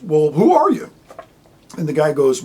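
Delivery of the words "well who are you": raised, louder baffled question; high and loud, imitating a baffled man; mock bafflement. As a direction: act out an exasperated, puzzled demand. Retold baffled question to the spirit.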